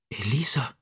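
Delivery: breathless whispered name; voiceless whisper of the dying woman's name. A narrator's reading. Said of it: "flüsterte Rizzi".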